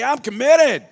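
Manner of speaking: loud committed declaration; call and response. Shown as a demonstration. Motivational speaker leading the crowd, loud. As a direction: proclaim it to the room. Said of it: "raise your hand and say".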